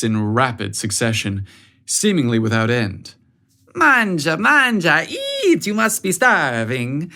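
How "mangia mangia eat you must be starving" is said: Italian aunt feeding her nephew; Italian accent. A narrator's reading. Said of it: "in her heavy Italian accent".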